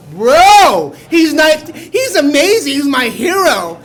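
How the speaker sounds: loud, high fanboy gush; thrilled gushing. Retold or imitated. Driver gushing about his old-man hero, loud and thrilled. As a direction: gush over your hero, voice climbing.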